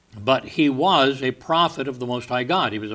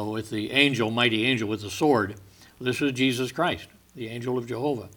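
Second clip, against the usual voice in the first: usual speaking voice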